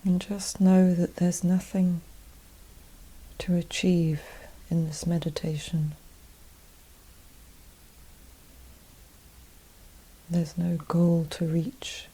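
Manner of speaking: guided meditation. Acted. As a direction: gently guide them inward, unhurried and even, leaving space after each phrase.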